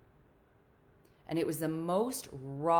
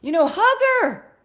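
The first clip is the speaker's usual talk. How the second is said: much higher and louder command; insistent mom; 17 dB louder than usual. Retold or imitated. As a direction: urge him on, exasperated. Mom repeats order, laughing.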